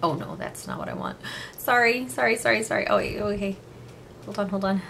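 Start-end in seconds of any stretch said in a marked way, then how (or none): none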